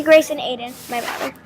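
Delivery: ordinary delivery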